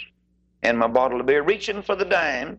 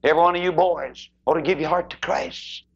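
ordinary talk

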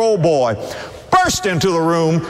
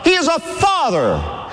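higher, slow, shouted proclamation; stronger preached repeat; 9 semitones higher than usual. Real, not acted. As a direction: lift it even higher to the crowd. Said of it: "repeat, higher and stronger".

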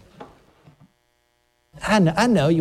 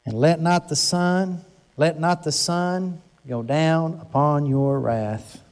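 slow solemn scripture reading; slower than usual. Shown as a demonstration. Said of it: "slow scripture reading".

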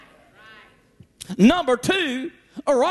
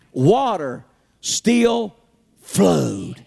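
preaching; 6 semitones lower, 4 dB louder, much slower than usual. Acted. Preacher's climactic drawn-out line.

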